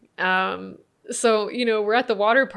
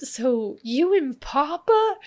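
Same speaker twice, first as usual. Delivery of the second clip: slow, high, giggly question; son laughing in disbelief; 7 semitones higher, 4 dB louder, much slower than usual. Retold or imitated. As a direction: ask it half-laughing like a shocked kid. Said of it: "He's like".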